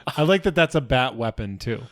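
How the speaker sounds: ordinary talk, nothing marked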